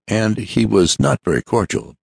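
ordinary delivery, nothing marked

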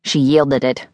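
ordinary delivery, nothing marked

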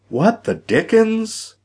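high-pitched, baffled woman's voice; startled exclamation. A narrator's reading. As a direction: exclaim in high, puzzled surprise.

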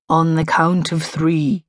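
slow, measured hypnotist; lightly put-on hypnotist voice; deep hypnotic voice. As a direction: intone it slowly, like a stage hypnotist. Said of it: "in my best deep hypnotic voice".

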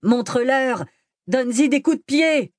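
high-pitched heckling cry; yelled encouragement. A narrator's reading. Convict goads the giant in a brawl, high yell. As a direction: call out high, cheering on the fight. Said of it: "l'un d'eux hurla au géant".

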